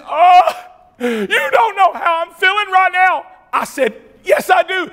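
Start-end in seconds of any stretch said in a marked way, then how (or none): 0.1-3.3 high, crying plea of a broken man; retold weeping stranger; tearful hitchhiker. 4.2-4.9 high, loud insistence; retold insisting answer; insistent reply. Arguing back with the man.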